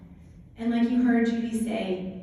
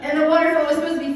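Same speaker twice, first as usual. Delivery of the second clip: giggling while telling it, a bit louder; laughing; 5 semitones higher, 5 dB louder than usual. Real, not acted. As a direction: laugh through it at the absurd climb. Laughing retelling the endless steps.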